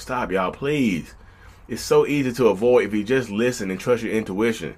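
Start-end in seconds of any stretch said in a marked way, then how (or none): 0.0-1.1 very slow, drawn-out plea; exasperated plea. Retold or imitated. Host fed up with listeners ignoring red flags, slow tired plea. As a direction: drag each word out, worn out and begging. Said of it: "I'm just like".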